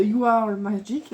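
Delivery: slow, careful English with a heavy French accent; French speaker's clumsy English accent, slow; bad English accent. Real, not acted. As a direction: sound out the English words slowly in a thick French accent. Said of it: "mon accent est pourri".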